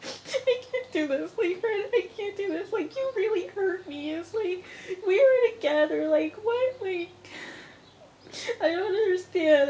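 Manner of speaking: very high-pitched imitation of tearful pleading; mock crying. Vlogger imitating a reality-show woman crying to her man, mock crying. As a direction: put on a squeaky, weepy whine. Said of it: "And then she start crying".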